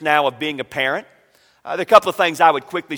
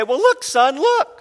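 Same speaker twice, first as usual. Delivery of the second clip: high, loud, excited dad voice; dad showing his son the living turtle, excited; 10 semitones higher, 6 dB louder than usual. Retold or imitated. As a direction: call out in surprised delight.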